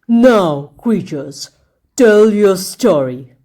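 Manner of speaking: a cruel king savouring his threat, slowly; wicked king. A narrator's reading. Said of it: "he commanded".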